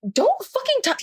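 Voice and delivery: high-pitched, indignant warning; retold outrage, voice jumping high; livid protest. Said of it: "and I was livid".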